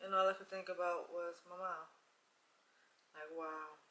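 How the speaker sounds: quiet, heavy recollection, trailing into silence; hushed, sombre. Real, not acted. Woman recalling her miscarriage and her late mom, hushed.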